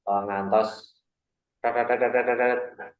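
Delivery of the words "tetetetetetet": quick, even machine-like stutter of syllables; rapid rattling. Shown as a demonstration. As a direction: rattle off syllables like spilled corn. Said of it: "Grontol kutah".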